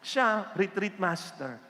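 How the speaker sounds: normal talking voice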